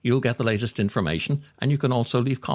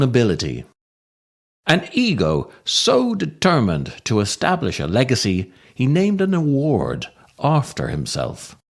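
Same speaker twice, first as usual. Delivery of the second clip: solemn eulogy. Acted. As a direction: read it like a mock-solemn eulogy at the graveside. Mock eulogy read over music.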